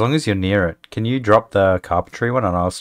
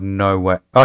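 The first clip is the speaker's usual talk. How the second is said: usual speaking voice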